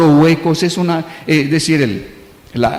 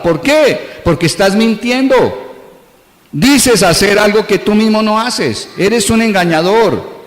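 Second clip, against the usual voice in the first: higher-pitched rising sermon rebuke, ending slow on engañador; preaching rebuke; 4 semitones higher than usual. Acted. Preacher rebuking the congregation for empty words, in a raised voice. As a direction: press the accusation home to the congregation.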